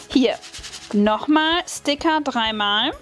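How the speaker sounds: usual speaking voice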